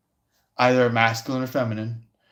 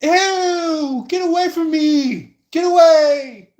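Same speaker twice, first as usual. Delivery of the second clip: much higher, louder, slow shrieking get-away; disgusted shriek; 6 dB louder, slower than usual. Retold or imitated. Women shrieking at a creepy guy in disgust. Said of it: "the women are going like".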